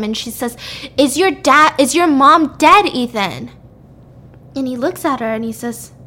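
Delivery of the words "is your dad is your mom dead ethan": mock yell, louder and higher; retold scream. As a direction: act out an upset, annoyed shout. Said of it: "she just screams at him".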